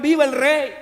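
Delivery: loud cry of long live the king; crowd shout. Retold or imitated. Preacher shouting as the cheering crowd hails the king. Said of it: "Algunos gritaron".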